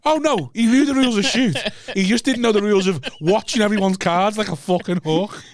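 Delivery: high and loud, laughing it out. Real, not acted. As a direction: laugh while explaining the trick. Laughing explanation.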